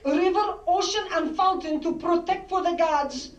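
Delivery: put-on tender, grand Greek-accented father announcing destiny; Greek father's accent. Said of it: "spoke with a thicker accent than my father".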